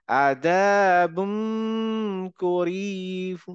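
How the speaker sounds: আদাবুম করিব drawn out, the nasal held; slow recitation. Shown as a demonstration. Teacher reciting the example slowly for students. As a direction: chant আদাবুম slowly, holding the hum. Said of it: "এরকম".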